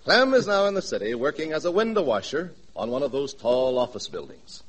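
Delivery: normal talking voice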